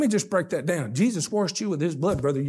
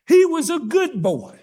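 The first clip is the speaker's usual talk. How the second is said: mock quote; 12 semitones higher, 7 dB louder, slower than usual. Mocking the father's claim.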